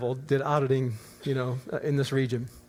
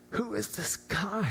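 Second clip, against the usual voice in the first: soft, slow, higher-pitched wonder; hushed awe; 4 semitones higher, 8 dB quieter, slower than usual. Retold or imitated. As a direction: marvel quietly, blown away. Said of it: "absolutely blew us away".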